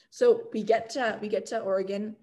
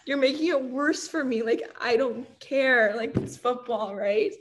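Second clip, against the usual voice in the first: higher, louder, half laughing; laughing inner thought; 3 semitones higher, 4 dB louder, slower than usual. Retold or imitated. Kicker laughing at teammates making it worse, higher and louder. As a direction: giggle through the exasperation. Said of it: "I'm just thinking".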